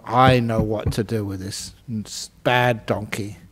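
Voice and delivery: low, soft scheming mutter; scheming farmer. Acted. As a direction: plot it under your breath.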